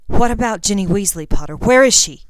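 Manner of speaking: loud, urgent demand; frantic. A narrator's reading. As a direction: frantically press him for an answer. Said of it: "Even Draco sounded frantic".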